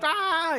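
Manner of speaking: high mock scream "ah"; imitated yell. Everyone on the swerving bus, yelling. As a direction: imitate a busful of kids yelling.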